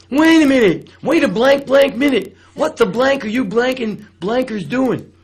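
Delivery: hammed-up outraged owner stopping the show; angry club owner. Owner jumps on stage to stop the dancing, furious shout. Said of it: "he talked like this".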